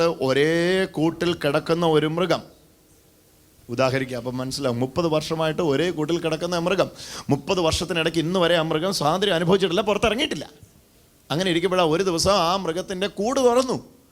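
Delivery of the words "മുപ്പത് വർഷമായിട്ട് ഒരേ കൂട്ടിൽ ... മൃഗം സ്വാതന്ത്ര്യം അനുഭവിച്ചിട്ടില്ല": ordinary talk, nothing marked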